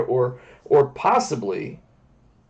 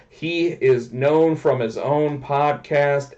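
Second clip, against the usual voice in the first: ordinary delivery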